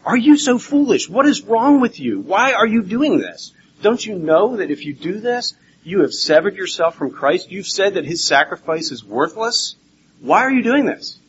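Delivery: high, exasperated scolding; Paul, really upset. Retold or imitated. Preacher voicing Paul to the Galatian church, upset. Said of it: "he's also really upset".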